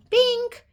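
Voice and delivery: short, loud, high "bink"; spoken sound effect. Acted. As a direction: pop out a cartoon sound effect. Imitates being locked away.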